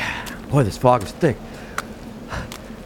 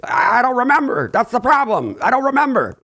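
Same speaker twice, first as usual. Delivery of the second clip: put-on higher, frightened old grandmother's voice; spooked grandma; 8 semitones higher than usual. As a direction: play the grandma who is rattled by the scratching. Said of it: "your grandma here is starting to freak us out".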